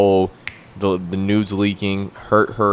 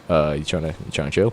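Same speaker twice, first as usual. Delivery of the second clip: casual flirt; 6 semitones lower, 4 dB quieter than usual. Shown as a demonstration. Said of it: "The other way was sounded a little borderline".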